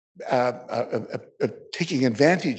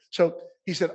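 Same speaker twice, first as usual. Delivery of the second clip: ordinary delivery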